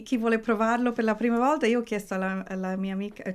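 ordinary talk

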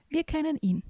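lightly voiced chorus-member calling out. A narrator's reading. Forest sprites answering who knows him, calling out. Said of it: "riefen die Pilzgörbse".

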